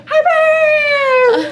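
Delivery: high, loud wail of a name; very high, drawn-out yell; overjoyed cry. Acted. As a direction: squeal it out as you leap into a hug. Monty leaps onto Harper's lap.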